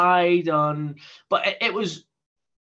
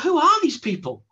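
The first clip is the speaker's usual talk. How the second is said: higher, incredulous retold question; raised pitch, recalling his amazement; retold disbelief; 8 semitones higher, 3 dB louder than usual. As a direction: wonder aloud in mild bafflement. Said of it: "I was like".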